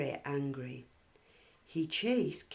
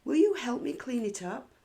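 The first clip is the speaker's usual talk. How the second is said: higher, louder, faster plea; eager child asking quickly, higher; pleading child; 7 semitones higher, 7 dB louder, faster than usual. Acted. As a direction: Katie begging the girls for help. Katie pleads with the girls.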